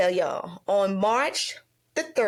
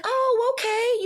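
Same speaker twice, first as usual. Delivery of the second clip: high, louder retold "oh, okay"; acted realization; 14 semitones higher, 4 dB louder, slower than usual. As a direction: act out getting it, pitched way up. Acted reaction to his explanation.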